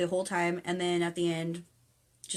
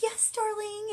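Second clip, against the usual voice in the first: high, sugary mock-parent voice; indulgent parent; 14 semitones higher, slower than usual. Imitating a parent answering a brat, high indulgent voice. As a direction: parody a parent giving in sweetly.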